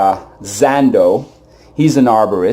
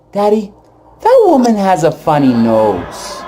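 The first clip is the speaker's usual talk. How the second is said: put-on child's loud whisper; 8 semitones higher than usual. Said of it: "he whispers in my ear".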